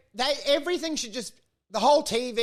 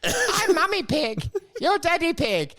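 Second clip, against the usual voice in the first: higher, slow lightly cartoon Peppa voice; Peppa Pig intro; 8 semitones higher, slower than usual. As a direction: chirp it like the cartoon opening. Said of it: "theme for Peppa Pig".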